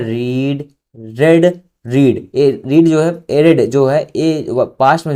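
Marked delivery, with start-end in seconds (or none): none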